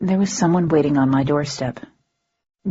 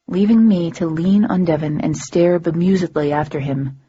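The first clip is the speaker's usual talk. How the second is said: ordinary delivery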